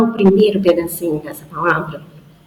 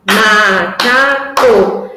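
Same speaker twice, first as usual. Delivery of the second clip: very slow and louder, syllable by syllable; clapped syllables; 5 dB louder, much slower than usual. Shown as a demonstration. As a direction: mark each syllable as if clapping. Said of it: "Pode ser com uma palma".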